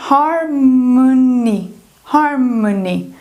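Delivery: "harmony" drawn out as har-m'-ny; slow schwa bridge. Shown as a demonstration. Teacher showing the bridge between M and N, slow reduced "harmony". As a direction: stretch "harmony" slowly, O only a bridge from M to N. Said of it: "ele é somente uma ponte".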